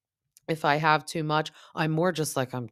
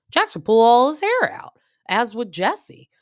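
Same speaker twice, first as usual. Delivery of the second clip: excited exaggeration; 10 semitones higher, 10 dB louder than usual. Real, not acted. Imagining Jax overwhelmed by babies.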